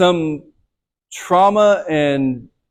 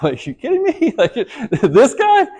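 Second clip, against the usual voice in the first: incredulous; 10 semitones higher, slower than usual. Retold or imitated. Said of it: "I'm like".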